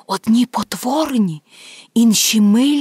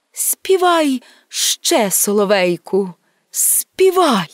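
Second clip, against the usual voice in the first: Death entreating; slower than usual. Acted. Death begs the nightingale for more, entreating. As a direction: urge the singer on, stretching the command.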